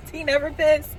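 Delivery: cracking up mid-phrase; laughing. Real, not acted. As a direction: giggle it out.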